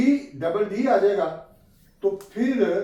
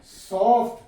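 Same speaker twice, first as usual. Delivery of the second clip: Soft higher and louder, rising; stressed soft; 4 semitones higher, 7 dB louder, slower than usual. Shown as a demonstration. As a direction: lean on the word soft. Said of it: "तो डी की आवाज". (Soft पर ज़ोर)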